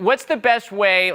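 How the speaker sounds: ordinary talk, nothing marked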